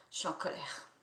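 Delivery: breathy whisper, held in; anger kept under the breath; hushed, suppressed fury. Shown as a demonstration. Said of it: "on peut y aller".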